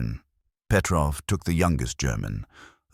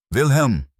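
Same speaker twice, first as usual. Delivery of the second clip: a sudden name, much higher and louder; sudden outburst; 10 semitones higher, 7 dB louder than usual. A narrator's reading. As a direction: blurt the name out of the silence. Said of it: "the old man said suddenly".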